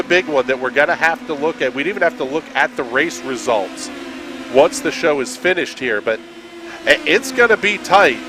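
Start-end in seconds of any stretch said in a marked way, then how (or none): none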